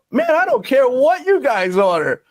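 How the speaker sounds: high-pitched imitation of an exasperated boss; retold dismissive boss. Acting out boss's dismissive reply.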